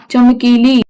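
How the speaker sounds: चमकीली slowly, ending on a long held ई; long ई shown. Shown as a demonstration. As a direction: stretch the final ई of चमकीली. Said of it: "बड़ी ई आ रही है". (चमकीली की आख़िरी ई खींचकर)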